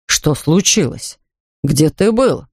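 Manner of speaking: puzzled father asking the bear; slow, worried Mr Brown. Acted.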